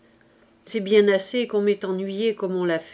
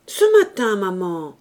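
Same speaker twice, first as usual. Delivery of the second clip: protesting son; 8 dB louder than usual. A narrator's reading. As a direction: answer your mother in a child's protest. Son answers mother's accusation. (fils qui proteste, finale descendante)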